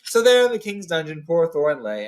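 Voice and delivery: ordinary delivery, nothing marked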